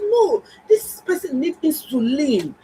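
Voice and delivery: slow, emphatic, pleading insistence; urgent insistence. Retold or imitated. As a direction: press each word slowly, near tears. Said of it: "I said".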